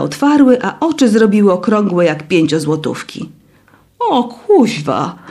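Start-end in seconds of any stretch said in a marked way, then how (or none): 4.0-5.2 slow breathless gasp of shock; panted. A narrator's reading. Zuta gasping in shock at the dining hall. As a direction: puff it out, stunned and out of breath. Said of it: "wysapała Zuta".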